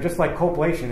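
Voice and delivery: normal talking voice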